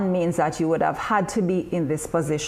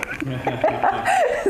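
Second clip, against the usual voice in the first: amused laugh; 5 semitones lower than usual. Real, not acted. Laughs at own sales pitch.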